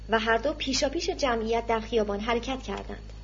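usual speaking voice

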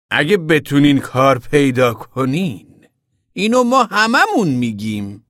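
loud, high, drawn-out retort; fiery retort. A narrator's reading. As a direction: throw it back slowly and forcefully. Woman's eyes turn fiery. (تند و آتشین، با صدای بلند و کشیده)

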